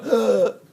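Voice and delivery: a jolt of fright pushed out as a grunt; startled grunt. Retold or imitated. Reacting to a scare.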